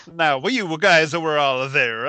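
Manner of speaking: slow, overdone faux-Latin accent; mock Latin accent. Doing the Latin voice his friend asked for, mock Latin accent. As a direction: ham up a fake Latin accent. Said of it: "sounded like one comedian".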